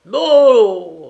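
loud, high, drawn-out no; stubborn drunken protest; insistent refusal. A narrator's reading. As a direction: push him away with the voice. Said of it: "répéta Petouchkov en repoussant Onissime".

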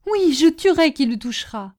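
high-pitched, forceful vow; defiant threat. A narrator's reading. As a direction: threaten anyone who would touch your lover. Woman threatens to defend lover. (menace farouche, d'une voix aiguë)

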